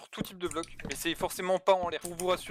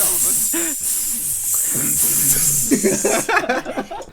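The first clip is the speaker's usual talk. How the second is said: sing-song laughter; 6 semitones higher, 9 dB louder than usual. Real, not acted. Rire chanté après les piques.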